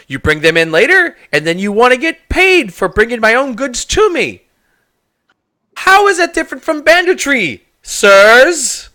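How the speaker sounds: high, loud rising indignation; indignant accusation. Acted. Shopkeeper accusing the adventurers of extortion. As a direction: accuse them of banditry, voice climbing.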